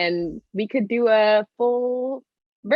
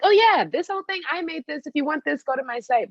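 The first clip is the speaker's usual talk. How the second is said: proud and breezy; 9 semitones higher than usual. Retold or imitated. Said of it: "I'm like".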